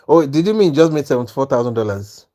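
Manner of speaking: ordinary talk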